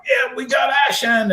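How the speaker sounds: high-pitched, giddy cry of delight; thrilled exclamation. Retold or imitated. Finally seeing eye shine after years of being asked, thrilled. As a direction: imitate yourself tickled to death, like a kid in a candy store. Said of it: "like a kid in a candy store".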